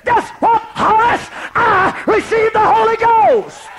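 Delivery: high, loud, tearful cry of joy; ecstatic shout. Retold or imitated. Wife bursting into her husband's study, ecstatic tearful shout. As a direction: burst out with the news near tears.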